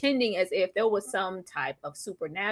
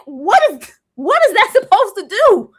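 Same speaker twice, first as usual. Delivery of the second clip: loud, higher outraged question; mock outrage; 8 semitones higher, 8 dB louder than usual. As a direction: demand it in disbelief. Outraged retold reaction.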